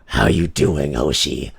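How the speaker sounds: low-pitched greeting without the mobster accent; dropping the accent. Shown as a demonstration. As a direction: reset to a deep male register. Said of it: "I gotta get out of this".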